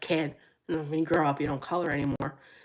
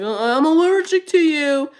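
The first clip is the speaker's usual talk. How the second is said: joking; 8 semitones higher, 6 dB louder than usual. Pretend. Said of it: "And we joke".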